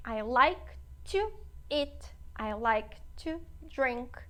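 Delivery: slow, deliberate teacher's demo; slow classroom model reading; slow model sentence. Shown as a demonstration. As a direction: spell each word out slowly for the class. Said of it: "teacher models English sentences".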